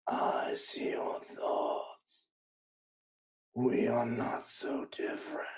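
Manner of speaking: eldritch horror's slow, raspy whisper. Acted. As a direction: whisper it slowly in their ear like a monster. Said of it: "Its voice sounds like a raspy whisper in your ear".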